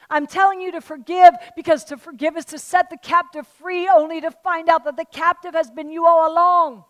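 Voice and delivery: loud, high, fervent sermon delivery; impassioned preaching. Acted. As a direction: preach it with rising passion. Preaching at full force.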